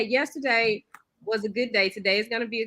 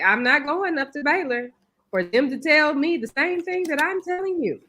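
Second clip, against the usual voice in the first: exasperated mom; 6 semitones higher, 4 dB louder than usual. Retold or imitated. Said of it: "I'm like".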